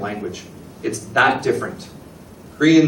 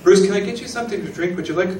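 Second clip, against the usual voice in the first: high, lilting offer of hospitality; lightly acted, higher-pitched invitation; polite host offer; 6 semitones higher, faster than usual. Shown as a demonstration. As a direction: play a host politely offering once more. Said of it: "The reason I'm asking three times".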